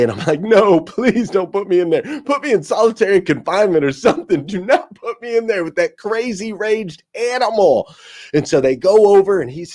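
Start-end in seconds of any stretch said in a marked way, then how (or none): 0.4-7.9 high-pitched mock begging; retold frantic plea. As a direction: plead in a panic, pitched up and rushing in places.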